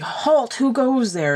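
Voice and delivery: high-pitched mock sentry challenge; three-headed knight. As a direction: mimic a comic knight calling a challenge. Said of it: "it's the dreaded three-headed knight".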